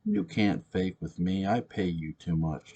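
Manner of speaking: usual speaking voice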